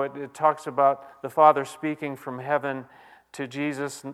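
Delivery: usual speaking voice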